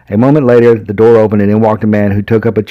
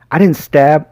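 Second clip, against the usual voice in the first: halting stammer; stuttering; 6 semitones higher, slower than usual. Acted. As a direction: stumble over the words. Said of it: "I stuttered".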